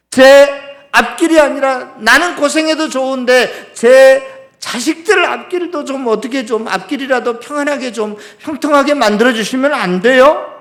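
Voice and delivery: resentful, high and loud pleading. Acted. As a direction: challenge God with one aggrieved demand after another. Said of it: "원망에 대한 기세가 굉장히". (원망하는 기세로 높게 따지듯)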